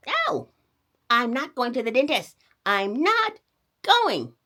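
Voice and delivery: put-on high, loud refusing boy; a stubborn kid yelling no; defiant boy. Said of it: "cried Sonny Boy".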